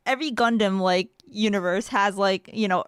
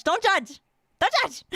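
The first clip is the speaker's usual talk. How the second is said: rising pleading; 8 semitones higher than usual. Real, not acted. Repeating plea higher each time.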